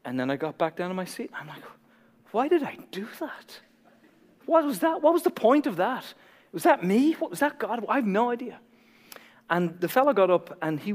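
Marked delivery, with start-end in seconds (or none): 2.3-8.6 high-pitched, baffled questioning; comic self-doubting confusion; retold bewilderment. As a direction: question yourself in high, puzzled bursts. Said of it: "I'm still, like, bewildered".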